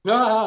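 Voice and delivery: high, loud mock cry; retold shout. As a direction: cry out as if fleeing in a dream. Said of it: "I'm shouting for my dad".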